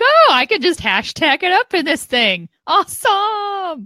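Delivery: high, gushing mock delight; mock excitement. Co-host imitates users finding room for hashtags, mock excitement. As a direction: imitate a thrilled user, pitch leaping up at the end.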